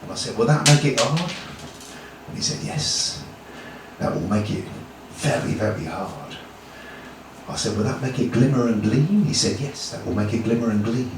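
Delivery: low, soft reverent exchange; hushed awe. Acted. Achilles asking swordsmith about the blade, hushed awe. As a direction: murmur it as if marvelling at the blade.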